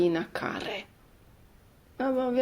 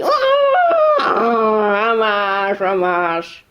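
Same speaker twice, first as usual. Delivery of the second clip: put-on loud boyish taunts; fighting boys; 6 dB louder than usual. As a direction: shove the words out like a scrapping kid.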